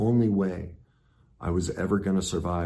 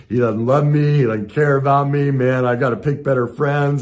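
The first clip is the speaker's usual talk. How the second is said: loud, high, hurt self-pity; victim spiral; 7 semitones higher, 11 dB louder than usual. Retold or imitated. Friend hasn't called back, he loudly plays the hurt victim. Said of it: "I went right into this".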